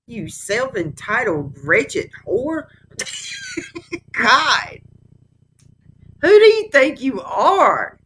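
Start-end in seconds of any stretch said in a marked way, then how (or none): 3.0-4.1 high laugh. Real, not acted. Reacting to insult he said.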